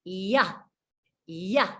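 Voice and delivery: the y slides open into yeah; Y glide. Shown as a demonstration. Teacher modelling the Y glide for learners, sliding open. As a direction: let the mouth open up like a slide. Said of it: "like I'm going down a slide".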